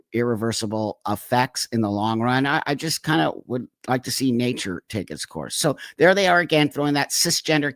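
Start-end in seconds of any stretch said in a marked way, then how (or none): none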